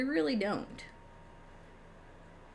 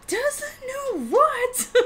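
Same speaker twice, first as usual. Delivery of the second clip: very high, very slow, laughing through it; hammed-up disbelief; 5 dB louder, much slower than usual. Topping her own mock outrage, squealing slowly with a laugh. Repeats again, even more outraged.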